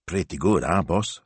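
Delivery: plain reading voice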